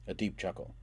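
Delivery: plain reading voice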